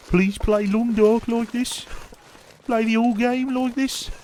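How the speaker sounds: high, loud, drawn-out Brummie parody. Shown as a demonstration.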